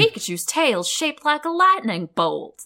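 Southern TV priest, badly imitated; mock televangelist with a bad Southern accent; terrible Southern accent. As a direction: do a hammy Southern preacher. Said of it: "That's my terrible Southern accent".

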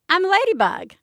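put-on high little girl voice; ladybug voice. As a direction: chirp it like a small child. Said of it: "And she says".

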